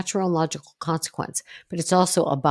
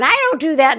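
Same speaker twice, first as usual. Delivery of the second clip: sharp, high and loud defiant retort; a much higher, louder "I don't do that"; defensive snap; 10 semitones higher, 8 dB louder than usual. Acted. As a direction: fire it back like a defensive teen. Teen snapping back defensively.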